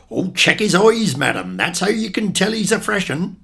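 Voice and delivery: loud, high-pitched Devon fishmonger; emphatic market patter with a Devon accent; broad Devon accent. Acted. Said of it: "invariably say in his broad, deafened accent".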